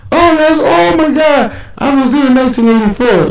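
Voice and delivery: happy Russian parents. Retold or imitated. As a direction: gush with delight as an excited parent. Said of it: "they get happy".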